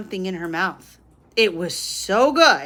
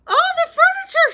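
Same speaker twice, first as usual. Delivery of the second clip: very high, loud dismayed cry; cried out; 4 dB louder, faster than usual. A narrator's reading. Missus Rogers crying out over faded furniture. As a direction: shriek at the ruined furniture. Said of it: "she cried".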